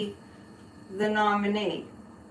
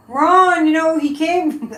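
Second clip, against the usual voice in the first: a hint of Trump, louder and higher; half-hearted Trump voice, loud start; Trump impression; 5 semitones higher, 6 dB louder than usual. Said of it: "I can't do a Donald Trump".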